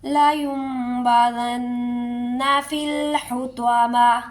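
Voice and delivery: slow melodic tilawat with iqlab; Quran recitation. Shown as a demonstration. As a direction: recite slowly, nun turning to meem. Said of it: "تو اقلاب کیسے ہوگا؟". (اقلاب کے ساتھ تلاوت)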